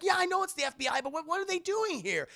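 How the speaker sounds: high, exasperated youngster's voice; acted high-pitched fluster; flustered Peter. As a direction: protest in bewildered alarm. Peter flustered, answering his AI.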